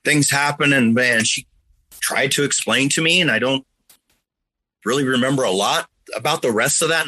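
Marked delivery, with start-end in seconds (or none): 0.0-0.7 mom's gentle voice. Retold or imitated. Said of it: "And then she said".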